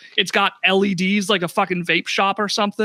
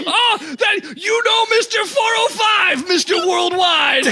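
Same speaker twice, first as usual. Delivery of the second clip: high, laughing put-on Jamaican shout; hammed-up Jamaican with giggles; Jamaican accent; 11 semitones higher than usual. Shopkeeper naming [name], shouting in Jamaican accent. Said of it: "Jamaican accent".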